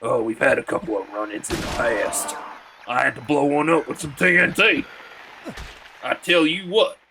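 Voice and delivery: put-on southern US accent. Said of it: "whenever I try to do the southern voice".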